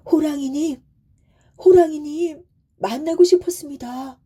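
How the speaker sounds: high, eager shout to the tiger; glad calling out. A narrator's reading. Son greets the fearsome tiger, shouting gladly. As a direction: call out fearlessly, as if glad to meet. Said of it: "소리치는 게 아니겠어요".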